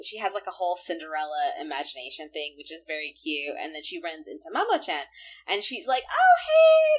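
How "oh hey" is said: high, loud squeal of hey; hammed-up girlish squeal; squealing Usagi greeting. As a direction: gush at him like a lovestruck girl. Usagi greeting Mamo-chan.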